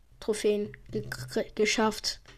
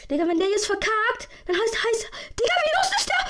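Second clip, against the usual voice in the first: high, loud panicked yelling; frantic yelling; 10 semitones higher, 4 dB louder than usual. Real, not acted. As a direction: scream at the screen. Teammate about to fail.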